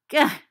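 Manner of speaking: sharp gah as the splinter digs in; pained yelp. A narrator's reading. Sirius jabbed by a splinter, yelping in pain. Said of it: "he says with great feeling".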